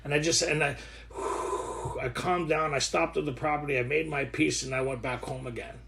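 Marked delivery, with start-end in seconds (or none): 1.1-2.0 long, audible breath out; calming exhale. Acted. Speaker shows calming down before confrontation, calming exhale. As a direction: blow the tension out slowly. Acts out pausing to calm down.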